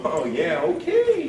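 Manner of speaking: mock-polite, leaping up high on okay; fake agreement. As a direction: humor them with an exaggerated high "okay". Hotel invitee feigns agreement.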